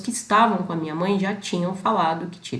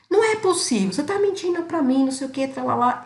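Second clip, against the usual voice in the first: much higher, accusing, distraught mother's voice; imitating upset mother; 7 semitones higher than usual. Mother accusing daughter on phone of lying, imitated distraught. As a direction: mimic the mother refusing to believe her, hammed up. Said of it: "Ela tava nervosa".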